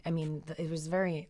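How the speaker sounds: ordinary talk, nothing marked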